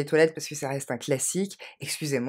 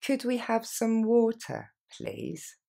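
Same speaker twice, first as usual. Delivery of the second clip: slow model; 5 semitones higher, slower than usual. Shown as a demonstration. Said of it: "Encore une fois".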